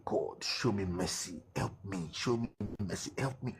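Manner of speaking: soft, pleading prayer; retold tearful prayer. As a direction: quietly beg God for mercy, lightly acted. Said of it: "I was crying".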